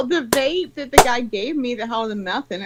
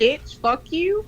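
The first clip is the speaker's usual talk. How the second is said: slow mock tough line through laughter; showing how a friend should snap, slow and laughing; tough voice demo, laughing; 6 semitones higher, slower than usual. Shown as a demonstration. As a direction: drag it out, laughing at yourself. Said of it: "say it in a real voice".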